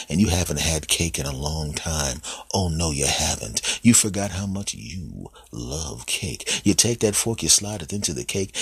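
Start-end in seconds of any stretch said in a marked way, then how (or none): none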